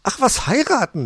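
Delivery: high, loud changed voice, laughing it off; laughing dismissal. A narrator's reading. Frau Elster laughing off the idea of marriage, high and amused. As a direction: brush it off with a laugh. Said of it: "lachte sie".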